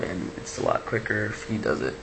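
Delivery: ordinary talk, nothing marked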